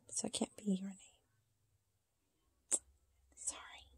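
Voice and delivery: hushed whisper, fast then fading. Real, not acted. Whispering to the doll that the name is taken. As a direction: confide it under the breath.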